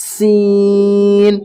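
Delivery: ordinary delivery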